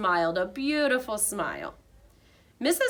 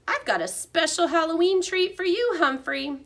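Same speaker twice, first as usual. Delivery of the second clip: cheerful janitor; 9 semitones higher, 4 dB louder than usual. A narrator's reading. Aldo voiced.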